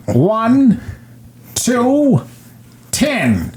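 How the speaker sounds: high drawn counts, dropping on the last; mock gangster countdown. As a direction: count down with theatrical menace. Gangster's mock countdown before shooting.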